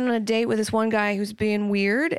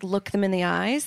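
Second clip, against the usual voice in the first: normal talking voice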